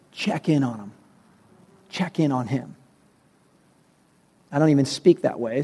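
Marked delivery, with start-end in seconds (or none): 0.1-0.9 softer, calm inner voice saying Check in on him; soft divine prompting. Retold or imitated. Preacher retelling God's voice to him, softer and calm. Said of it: "heard the voice of God say". 1.9-2.8 quiet voice of God. Retold or imitated. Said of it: "heard the voice of God say".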